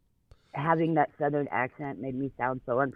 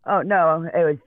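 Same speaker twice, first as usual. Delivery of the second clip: ordinary delivery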